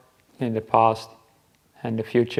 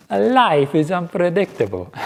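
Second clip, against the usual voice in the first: mock Italian accent, pitched higher and slow; 7 semitones higher, 4 dB louder than usual. Monk quoting his Italian housemate's catchphrase, playful Italian accent. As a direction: imitate an Italian ex-doctor in a warm Italian accent. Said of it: "said it with his Italian accent".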